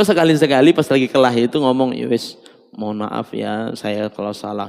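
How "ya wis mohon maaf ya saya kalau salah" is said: meek apology. Acted. Husband timidly apologizes to his angry wife, softly.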